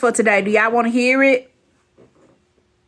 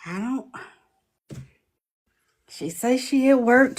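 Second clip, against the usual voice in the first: ordinary talk, nothing marked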